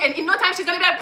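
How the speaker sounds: ordinary talk, nothing marked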